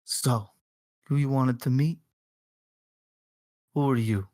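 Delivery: a hint of a hammed-up Italian accent, gangster asking; lightly put-on Italian accent, barely there; bad Italian accent. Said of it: "my bad Italian accent".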